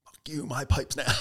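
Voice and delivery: mock tough guy; defiant. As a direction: snap it back, gloating. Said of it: "I was like".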